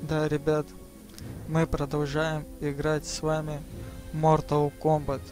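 usual speaking voice

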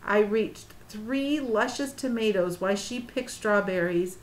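usual speaking voice

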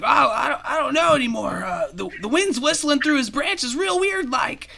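high squeaky spooked squirrel voice; uneasy squirrels. Acted. Squirrels spooked by wind in the branches, high and uneasy. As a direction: squeak it high, hesitant and unnerved.

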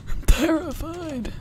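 high, slow hammed-up whimper; mock terrified wail. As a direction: drag it out like a scared kid.

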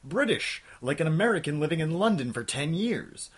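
usual speaking voice

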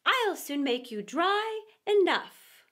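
loud, high call to order. A narrator's reading. Mouse calling the wet party to order, loud and commanding. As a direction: call out with slow authority on the last words. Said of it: "called out".

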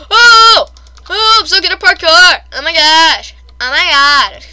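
loud, high burst of excitement; excited outburst. Real, not acted. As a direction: exclaim loudly, starting high. Bragging excitedly during parkour.

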